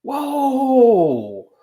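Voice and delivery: drawn-out amazed whoa; amazed at the Quran's words, drawn-out whoa; awed whoa. Retold or imitated. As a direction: react as if stunned by a revelation. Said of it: "I'd be like".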